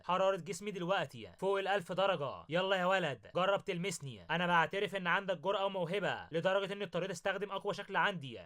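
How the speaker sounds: usual speaking voice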